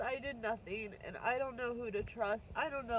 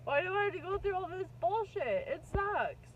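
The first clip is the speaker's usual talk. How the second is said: frustrated outburst; 7 semitones higher, 5 dB louder than usual. Real, not acted. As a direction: voice jumps high and loud in real frustration. Venting frustration at her situation.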